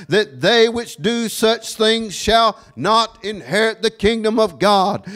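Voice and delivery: high, punched preaching cadence; forceful scripture reading. Real, not acted. Preacher reading the list of sins to the congregation, forceful declaiming. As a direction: ring out each item separately, falling at its end.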